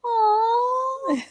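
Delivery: high, soft cooing aw. Retold or imitated. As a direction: melt at a cute blanket. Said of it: "I'm like".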